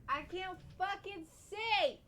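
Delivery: high, drawn-out frustrated whine; exasperated wail. Real, not acted. Cannot see to play.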